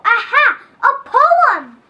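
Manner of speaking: loud, high burst of discovery; excited exclamation. Acted. Kid shouting as the poem idea strikes, excited. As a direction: shout it like a lightbulb just went on.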